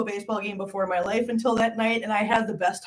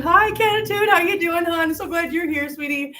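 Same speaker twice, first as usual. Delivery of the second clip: high, sugary hello to a regular; fond welcome to a viewer, pitched high; sweet greeting; 7 semitones higher than usual. Real, not acted. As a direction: greet her like a dear friend.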